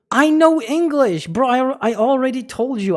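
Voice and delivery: high, loud insistence; exasperated protest. Real, not acted. To the stranger who doubts his English, a high, loud, fed-up protest.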